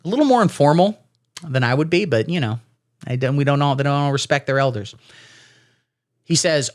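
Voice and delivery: usual speaking voice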